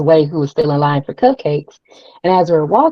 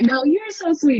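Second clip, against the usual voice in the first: high-pitched imitated gushing; sweet insistence; 9 semitones higher than usual. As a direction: return the compliment in a high sweet voice. Star returning compliment, retold.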